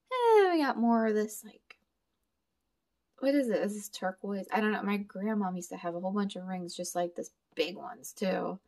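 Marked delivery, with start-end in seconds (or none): none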